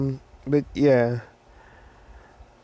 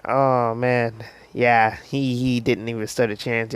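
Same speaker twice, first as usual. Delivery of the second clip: ordinary delivery, nothing marked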